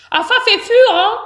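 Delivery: high, muffled worm voice while eating; mouth full. A narrator's reading. Worm eating chocolate hazelnuts, mouth full. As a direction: talk through a big mouthful. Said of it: "la bouche pleine".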